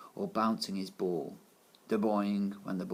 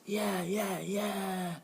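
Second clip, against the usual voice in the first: cheering class; 10 semitones higher, much slower than usual. A narrator's reading.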